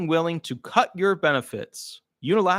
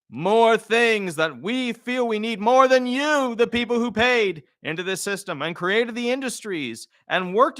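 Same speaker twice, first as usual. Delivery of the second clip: the government telling retirees it will renege; general's voice; 7 semitones higher than usual. Acted. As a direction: declare the broken promise grandly. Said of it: "That's how the generals would talk".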